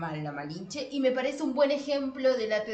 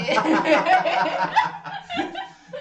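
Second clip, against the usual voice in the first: loud high laugh breaking off the sentence; 9 semitones higher, 10 dB louder than usual. Real, not acted. Host cracking up at her own Vivaldi joke. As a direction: burst out laughing mid-line.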